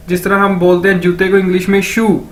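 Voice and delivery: ordinary delivery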